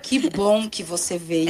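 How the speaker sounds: low, slow, unimpressed greeting; unenthusiastic welcome. Shown as a demonstration. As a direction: say the welcome without any delight. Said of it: "Dependendo como sai isso".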